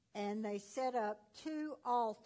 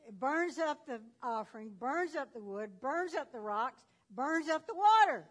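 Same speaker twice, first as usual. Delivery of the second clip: building excitement; 3 semitones higher, 8 dB louder than usual. Acted. Fire consuming everything.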